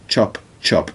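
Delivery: snarling, menacing; snarled. Acted. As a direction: snap it out with a snarl. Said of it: "He snarled".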